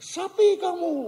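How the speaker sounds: high-pitched mock insult, called out; play-acted cross kid's high call; annoyed child. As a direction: imitate a fed-up kid calling names across a ravine. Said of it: "si anak makin jengkel".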